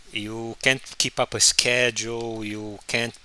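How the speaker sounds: ordinary talk, nothing marked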